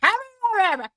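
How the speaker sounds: put-on thick, garbled Southern accent; Dixie. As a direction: slur it into an unintelligible Southern greeting. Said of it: "Dixie comes up into the camera and goes".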